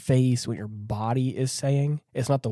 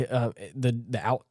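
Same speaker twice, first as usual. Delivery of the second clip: ordinary delivery, nothing marked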